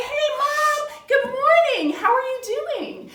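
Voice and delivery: high, loud, bubbly hello; exaggerated morning enthusiasm; cheery greeting. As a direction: act out an eager kid greeting mom.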